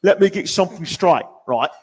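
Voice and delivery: cockney tough guy in a mock threat over pizza; angry British hardman. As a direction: threaten like a London tough, half-hearted. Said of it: "the angry Vinnie Jones British guy".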